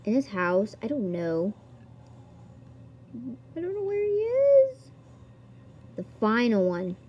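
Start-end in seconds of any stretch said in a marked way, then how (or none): 3.5-4.8 squeaky high voice. Pretend. Searching the game for Scar, squeaky high whine. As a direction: jump way up into a squeaky, cartoonish voice.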